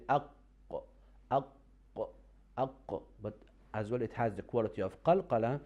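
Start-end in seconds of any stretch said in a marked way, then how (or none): 0.1-2.7 "aq" with a stopped q and a small echo; qaf demo. Shown as a demonstration. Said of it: "So you see".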